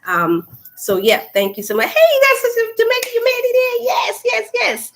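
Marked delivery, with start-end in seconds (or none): 1.9-4.9 excited greeting. Real, not acted. Greeting a viewer joining live.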